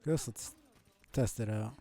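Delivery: ordinary talk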